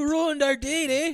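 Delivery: high-pitched mock Canadian girl; little Canadian girl. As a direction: whine it as an accusing Canadian kid. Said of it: "the little Canadian girl goes".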